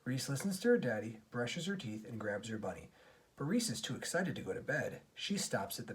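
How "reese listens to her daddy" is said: plain reading voice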